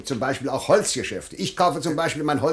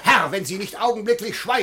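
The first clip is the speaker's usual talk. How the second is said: angry threat; 6 semitones higher, 3 dB louder than usual. Acted. Official threatens during quarrel.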